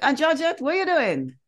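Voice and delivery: mock call across the bathroom. Calling out to aunt in shower.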